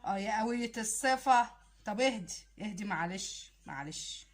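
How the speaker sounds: soothing. Acted. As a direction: hush her gently. Man soothing the upset woman.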